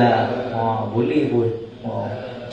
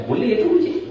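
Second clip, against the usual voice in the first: laughing reply; 8 semitones higher than usual. Retold or imitated. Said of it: "retold exchange with student".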